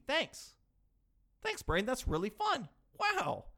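high-pitched mock gratitude; falsely cheerful sarcasm; sarcastic. Sarcastic thanks to own brain.

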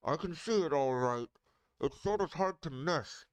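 muffled colonel voice, hand over mouth. Real, not acted. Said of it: "I put my hand over my mouth again".

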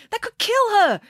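high, alarmed protest; crying out. Acted. Friday to the doctor with the defibrillator, crying out. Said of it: "cried Friday".

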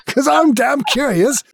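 high, slow comic Australian; laughing mock-Australian; Australian accent. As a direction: drawl it in a hammed-up Aussie accent. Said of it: "I don't know why I got Australian".

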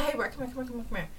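very soft, discreet retold call; no type of tone. Partner pulling him to the side, soft and harmless. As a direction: beckon him quietly aside. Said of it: "I said that to him in no type of tone".

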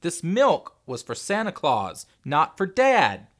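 slow, loud, high-pitched tantrum roar; furious child bellowing. A narrator's reading. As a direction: roar each word in stubborn fury. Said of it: "Henry roared".

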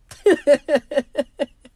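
big bright laugh; sudden loud laugh; loud burst of laughter. Real, not acted. Laughing at own confession.

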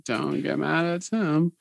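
put-on drunk girl voice. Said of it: "it's my drunk girl voice".

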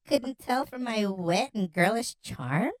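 coy question, drawn out and lilting up; playful flirty reply. Acted. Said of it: "there was far more sarcasm".

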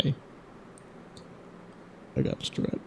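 mock raspy sick whisper; hoarse sick voice. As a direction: croak it out like you're sick. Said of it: "You'd be like".

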